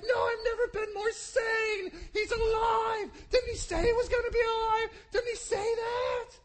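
high, loud, tearful pleading shout; ecstatic insistence. Retold or imitated. Mary insisting to disbelieving disciples, high, loud and tearful. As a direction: insist she's sane, nearly crying. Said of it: "and he's like".

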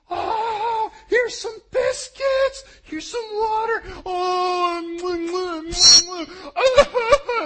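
very high, loud, hammed-up sobbing; mock bawling. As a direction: blubber through the kisses like a crazy stranger. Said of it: "starts bawling his eyes out".

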